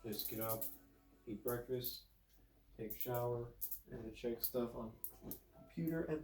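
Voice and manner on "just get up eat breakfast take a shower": ordinary talk, nothing marked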